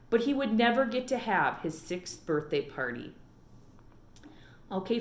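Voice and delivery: usual speaking voice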